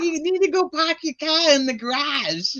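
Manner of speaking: mock Boston, R's dropped, high kid voice; Boston accent. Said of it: "he always sounds like he's from Boston".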